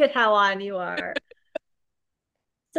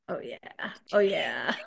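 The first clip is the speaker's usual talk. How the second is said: low, quiet mom voice; 5 semitones lower, 4 dB quieter than usual. Pretend. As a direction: answer like a cozy mom. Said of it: "hold up your mom voice for me".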